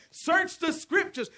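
slow, loud, high preacher's shout; shouted exhortation. Acted. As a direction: drive the command home to the crowd.

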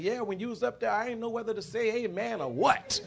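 piped up. Retold or imitated. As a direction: pipe up with the remark, voice lifted. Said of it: "one of the girls piped up".

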